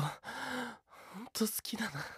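slow, teasing unvoiced whisper. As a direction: draw it out in a whisper.

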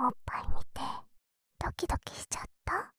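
breathy teasing whisper close to the ear. Acted. Little sister whispers teasingly to her brother. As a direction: whisper it like a secret tease.

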